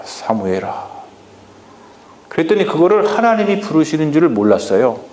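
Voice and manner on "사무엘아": lower, much softer falling call; God's second call to Samuel fading. Acted. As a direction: let the name sink away quietly. God calls Samuel again.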